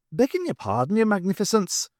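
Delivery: high, self-pitying humble plea; humble Irishman. A narrator's reading. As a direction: grovel in mock modesty. Pat interrupts.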